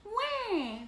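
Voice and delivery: high, loud imitated baby cry; storybook wail of crying babies; mock baby wail. A narrator's reading. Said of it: "the babies cried".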